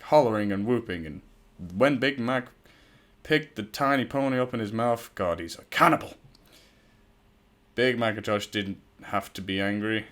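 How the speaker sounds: usual speaking voice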